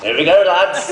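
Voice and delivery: rally the mates as the film starts; excited cheer. Retold or imitated. Actors cheering together as their film begins, excited and laughing. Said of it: "we're all next to each other going".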